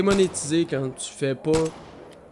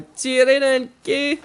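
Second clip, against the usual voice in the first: high, loud holler; taunting yell; 9 semitones higher, 7 dB louder, slower than usual. Acted. Player repeating a threat at the bear, taunting yell.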